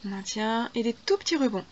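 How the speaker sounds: usual speaking voice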